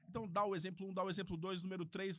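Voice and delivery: fast rattling delivery. Shown as a demonstration. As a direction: rush through the examples. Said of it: "falando rapidamente".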